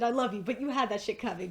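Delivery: normal talking voice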